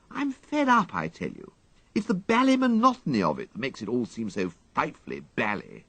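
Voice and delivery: fed up and moping at his valet; bored young gentleman complaining; morose young toff. A narrator's reading. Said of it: "proceeded Mister Wooster morosely".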